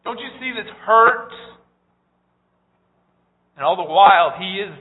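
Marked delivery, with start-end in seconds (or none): 0.0-1.3 crying out to God. Retold or imitated. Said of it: "yelled and cried at God".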